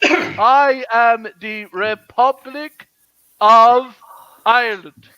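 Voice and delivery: very drawn-out, separated words; insistent, halting proclamation; slow, emphatic declaration. Real, not acted. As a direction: proclaim it slowly, pausing before the last words. Insisting on own Irishness in argument.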